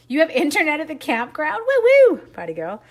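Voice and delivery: high, loud mock whoop; teasing cheer. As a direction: cheer her on like at a party. Teasing, cheering about campground.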